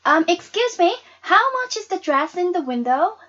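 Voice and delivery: put-on high, loud, timid young voice; hesitant girl. Girl asking the saleswoman the dress price, timid but loud. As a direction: ask the saleswoman nervously. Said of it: "I asked the saleswoman".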